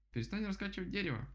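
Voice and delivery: высокий голос возмущённой птицы; потребуй высоко, изображая крик; крик дятла. A narrator's reading. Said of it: "закричал дятел". (возмущённо, голосом дятла)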